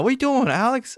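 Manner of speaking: high-pitched complaint; playful exasperation. Pretend. Host scolding co-host for breaking character, playfully exasperated.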